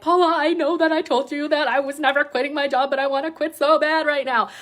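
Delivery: high, worked-up venting; retold anger. Venting to Paula about wanting to quit, re-enacted anger. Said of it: "I was so angry".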